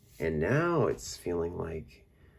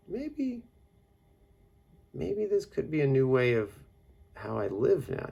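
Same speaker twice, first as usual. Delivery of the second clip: high, louder wondering tone; musing aloud; 12 semitones higher, 4 dB louder than usual. Retold or imitated. Recalling inner thoughts, high hopeful musing. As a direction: try the idea on with growing hope. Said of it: "And I'm like".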